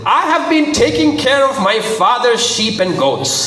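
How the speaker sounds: high-pitched, insistent testimony; persistent. A narrator's reading. David testifying to Saul, persistent. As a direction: press the case without giving up. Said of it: "David replied persistently".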